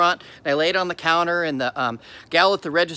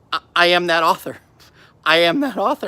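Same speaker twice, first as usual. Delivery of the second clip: retold choked-up declaration, louder; tearful; 5 dB louder than usual. Author to the bookstore clerk while crying, choked up. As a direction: push it out haltingly, as if through tears. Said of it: "and I'm crying".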